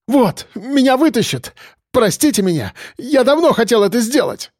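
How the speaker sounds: high, feverish outburst; pained cry. A narrator's reading. Policeman excuses himself to prisoners, pained high cry. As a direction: cry it out, overwrought. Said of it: "болезненно выкрикнул Чинчаков".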